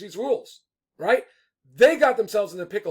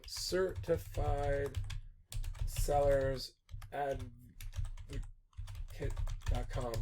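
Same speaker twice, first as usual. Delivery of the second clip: quiet, drawn-out words with long gaps; slow, word by word; 5 semitones lower, 10 dB quieter, much slower than usual. Real, not acted. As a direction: murmur it slowly to yourself while typing. Typing the address out slowly.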